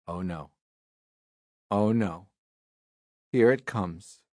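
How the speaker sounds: soft, low dread. Acted. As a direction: mutter it in growing dread. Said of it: "make silly sounds like".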